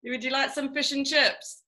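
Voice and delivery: playful Kiwi accent put on for friends; hamming up the Kiwi vowels; mock New Zealand accent. Kiwi guest teasing the Manchester hosts in her accent. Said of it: "I just love your accent".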